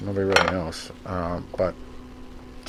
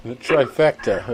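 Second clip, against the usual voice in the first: ordinary talk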